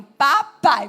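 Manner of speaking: loud toddler's first-word attempt; lightly mimicked small child; imitated toddler answer. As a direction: blurt it out like a little child learning. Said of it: "Aí o menino".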